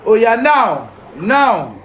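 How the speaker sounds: ordinary delivery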